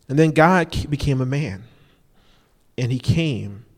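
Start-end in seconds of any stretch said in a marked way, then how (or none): none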